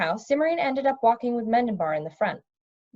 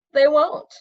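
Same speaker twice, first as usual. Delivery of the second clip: curt retort; 6 semitones higher, 7 dB louder than usual. Acted. Zeminar's curt answer.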